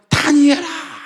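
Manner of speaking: high, loud call of the name; king shouting the name across the dark. Retold or imitated. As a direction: cry the name out toward the lions' den. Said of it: "막 소리를 지르는 거죠".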